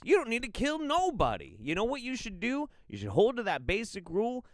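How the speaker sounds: high, hammed-up indignant protest; mock scolding. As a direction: plead and scold in exaggerated distress. Mock telling off the witch killer.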